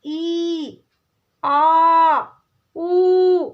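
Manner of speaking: teacher drawing out the long vowels. Shown as a demonstration. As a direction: stretch each vowel ई आ ऊ long. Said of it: "जो थोड़ा लंबा खींचता है".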